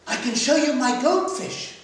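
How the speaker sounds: put-on a little girl keen to show off. Little girl offers her goldfish.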